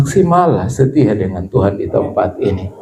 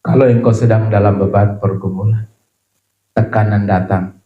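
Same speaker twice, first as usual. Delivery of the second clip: ordinary talk, nothing marked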